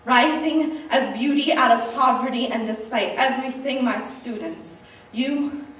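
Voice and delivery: heartfelt poetry recital to an audience; slam poem. Acted. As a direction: perform it like a slam poet, swelling with conviction then easing at the close.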